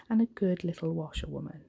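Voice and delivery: ordinary delivery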